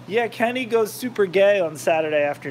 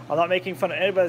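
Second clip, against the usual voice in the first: usual speaking voice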